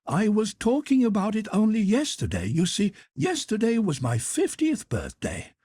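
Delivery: high, brisk matronly hotel cook; head cook voice. Acted. Head cook offering Carl a job, high and bustling. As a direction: lift the pitch high for the kindly head cook.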